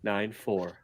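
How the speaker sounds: low, very soft murmur; mock ASMR voice. Shown as a demonstration. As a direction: drop down soft and slow as if for ASMR. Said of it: "like, an A S M R album".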